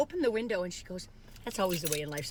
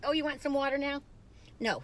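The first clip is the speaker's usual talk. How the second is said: ordinary talk, nothing marked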